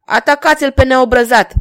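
loud, high roared order. A narrator's reading. King to his courtiers, roaring an order. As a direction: roar the command to attack. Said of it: "urlă regele".

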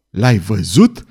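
mild yell, leaping up at the end; yelled question. A narrator's reading. Queen questions the astrologer about her living son, mildly yelled. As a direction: exclaim it in disbelief, voice rising sharply. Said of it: "urlă regina".

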